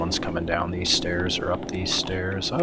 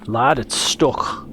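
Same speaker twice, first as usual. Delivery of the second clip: slow, drawn-out mock Irish accent; 2 semitones higher, much slower than usual. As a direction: put on an exaggerated Irish accent. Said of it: "my best Irish accent".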